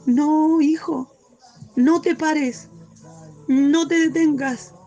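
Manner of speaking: pleading shout urging them not to stop; the Lord calling out. Acted. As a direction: cry out to the child to keep going. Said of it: "dando voces diciendo".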